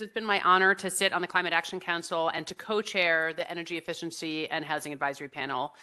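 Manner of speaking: ordinary delivery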